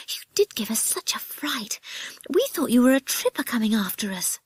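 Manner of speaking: put-on high, breathless girlish reproach; startled girl. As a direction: scold lightly after a fright. Girl recovering from a fright.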